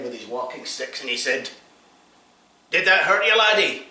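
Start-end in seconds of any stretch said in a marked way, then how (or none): 2.7-3.9 loud, high bellowed question; sergeant shout. Acted. Sergeant shouts at the soldier he just hit, high and loud. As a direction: yell it down at the soldier.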